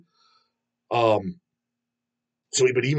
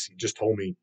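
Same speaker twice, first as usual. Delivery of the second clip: ordinary delivery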